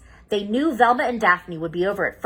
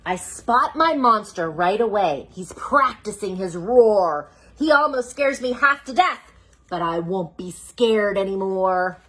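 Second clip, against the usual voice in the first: put-on reading to children; 3 dB louder than usual. Reads picture book aloud.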